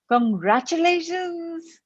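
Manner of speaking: high, drawn-out 'congratulations'; imagined friend's congratulations. Acted. Imagined loved one congratulating the listener, high drawn-out cheer. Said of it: "he's saying to you".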